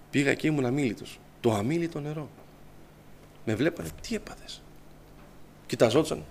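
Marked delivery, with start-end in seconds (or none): 4.0-4.6 hushed, breathy whisper among classmates; whispered question. Retold or imitated. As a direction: whisper it behind his back. Whispering classmates wonder at him.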